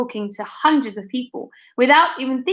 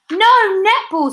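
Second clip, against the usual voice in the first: a higher, louder pupil shout; 9 semitones higher, 4 dB louder, slower than usual. A narrator's reading. Another pupil shouting: higher, louder. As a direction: call it out over the others. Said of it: "someone else shouted".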